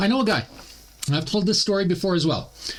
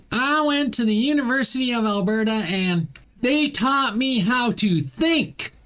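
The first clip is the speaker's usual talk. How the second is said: put-on Southern accent; 6 semitones higher than usual. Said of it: "I'm giving her a Southern accent".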